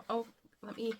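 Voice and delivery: ordinary talk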